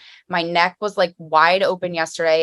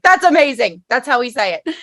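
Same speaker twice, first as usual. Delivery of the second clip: high, loud burst of delight; 5 semitones higher, 5 dB louder than usual. Retold or imitated. Host recalls seeing the sign, high delighted exclamation. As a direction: exclaim it, thrilled to be recognised.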